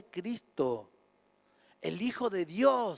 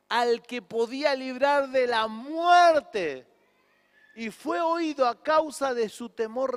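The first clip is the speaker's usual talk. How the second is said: slow solemn preaching; 4 semitones higher, 5 dB louder, slower than usual. Acted. Preaching scripture.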